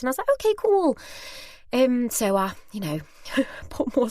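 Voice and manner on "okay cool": high-pitched retold agreement; breezy acceptance. Shopper accepting her place in the queue, breezily. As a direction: give in brightly, high up.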